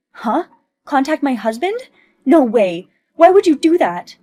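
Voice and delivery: high, loud alarmed protest; panicked shout. Acted. Olivia panicking as her friend contacts her husband, loud protest. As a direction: cry out in horror at the threat.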